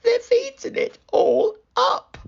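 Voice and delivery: very high, loud little-cub wail; tiny voice turned loud; upset squeaky Baby Bear. Acted. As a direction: squeak it out in distress. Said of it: "squeaked Baby Bear in his tiny voice".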